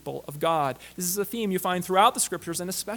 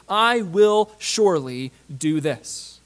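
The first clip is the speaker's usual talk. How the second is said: slow, loud and weighty, every word pressed; emphatic; 6 dB louder, slower than usual. Acted. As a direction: lean on "surely" like a solemn vow. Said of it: "by stating it emphatically".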